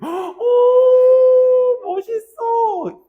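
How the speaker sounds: very high, loud drawn-out swoon; hammed-up squeal of awe, stretched slowly; mock admiring reaction. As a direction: play an awestruck fan. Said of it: "요런, 요런 리액션이야".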